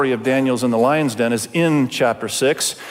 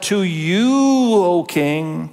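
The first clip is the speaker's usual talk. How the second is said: sarcastic fawning, YOU long and raised; 3 semitones higher, much slower than usual. Acted. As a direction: stretch it slowly into mock flattery. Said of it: "can you hear the sarcasm?".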